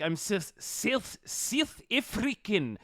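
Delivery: lightly voiced South African accent, vowels flattened; imitating a South African saying his nationality; mock South African accent. Friend mocking South African speech with a light South African accent. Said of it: "they have this weird way of talking where they're like".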